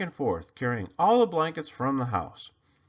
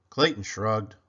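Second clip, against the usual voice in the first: ordinary delivery, nothing marked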